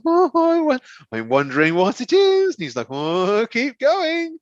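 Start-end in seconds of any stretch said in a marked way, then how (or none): 0.0-2.5 high, curious mum; mum's curious voice. Retold or imitated. Teller voicing mum unwrapping box after box, high and curious. 2.9-4.4 high teasing dad voice. Retold or imitated. Teller voicing dad urging mum to keep unwrapping, high and teasing.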